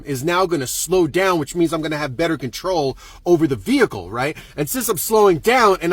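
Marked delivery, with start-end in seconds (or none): none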